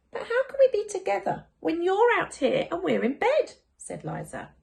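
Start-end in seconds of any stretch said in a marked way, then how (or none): 0.1-3.5 put-on higher, curious small child; a child asking, puzzled; lightly voiced child. Child character voice.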